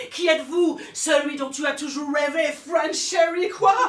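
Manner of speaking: hammed-up passion rising; excited. Erotic climax building in elevator.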